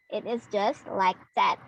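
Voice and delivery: normal talking voice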